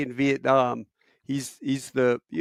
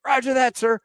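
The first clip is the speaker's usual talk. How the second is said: eager reply; 11 semitones higher, 4 dB louder than usual. Retold or imitated. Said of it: "he's like".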